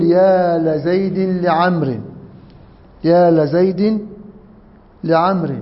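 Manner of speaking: slow long يا, then la-Zaydin li-Amrin; fatha then kasra. Shown as a demonstration. Teacher demonstrating the call for help, "la" in لزيد. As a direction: open "la" on لزيد, "li" on لعمر. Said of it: "بنفتح اللام بتاعته".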